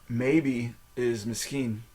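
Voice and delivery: "misschien" drawn out slowly as mis-skeen; slow teaching. Shown as a demonstration. Teacher slowing down the hard word for a learner. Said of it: "how to say misschien".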